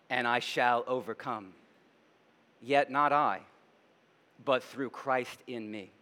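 hushed, reverent declaration of faith; solemn recitation. Real, not acted. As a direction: let each line settle before the next. Recites hymn words.

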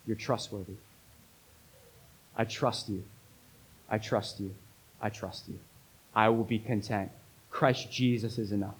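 low, hushed voice of trust; softly yield, with pauses between phrases; quiet surrender. Acted. Voices Paul's submissive response to God.

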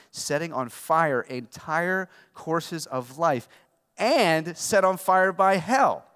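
slow, swelling to loud on hell; building scripture read. Acted. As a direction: build it up and slam the end. Reading scripture climax.

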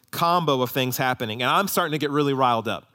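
ordinary talk

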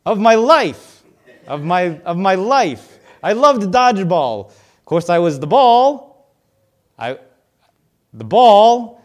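loud, eager comic monster doing bad stand-up; Mike Wazowski. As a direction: ham it up, desperate to get a laugh. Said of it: "and he's like".

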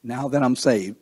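usual speaking voice

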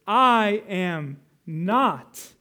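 "I am not" very slow, each word separate, "I" hit hard; slow, weighty "I ... am ... not"; emphatic I AM, NOT. Shown as a demonstration. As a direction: hammer out "I", pause on "am", land "not". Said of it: "But notice it here".

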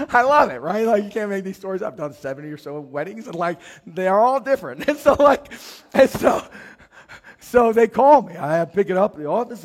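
excited storytelling. Real, not acted. Pastor delighting in the tomorrow wedding story, excited. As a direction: tell it like you can't believe it happened.

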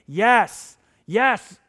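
loud, ringing shout of yes; booming divine yes, hammed up for the crowd; God screaming yes. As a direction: proclaim it as God shouting across the room. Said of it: "God is screaming".

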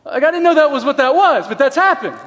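surprised realization breaking into a laugh; sudden realization. Retold or imitated. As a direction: relive the penny dropping. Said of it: "And I go".

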